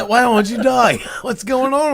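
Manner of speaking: high, hammed-up Australian; Australian accent. Joining the co-host's joke, playful Australian accent. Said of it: "a New York Italian doing an Australian accent".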